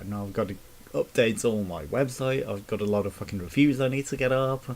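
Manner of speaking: ordinary delivery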